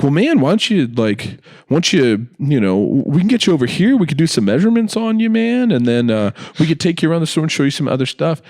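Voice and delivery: high, fast sales patter; mock pushy salesman. Clerk pushing measurements on Ian, in fast, high patter. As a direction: rattle off the upsell. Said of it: "the guy's like".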